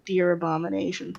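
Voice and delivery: ordinary delivery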